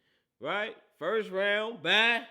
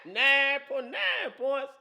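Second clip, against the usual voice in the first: slow, higher and louder, stretched through laughter; laughing disbelief; 6 semitones higher, 5 dB louder, much slower than usual. Real, not acted. Host mocking the Vikings' nine playoff points, laughing slowly. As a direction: drag out the number in amused disbelief.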